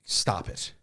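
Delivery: stern whispered scold. Retold or imitated. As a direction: hiss it so nobody hears. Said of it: "she was like".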